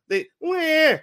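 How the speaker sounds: high, loud crying wah; mock baby wail. Imitating a baby whose toy was taken, high wail. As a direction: let out a baby's wail. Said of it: "when babies need help".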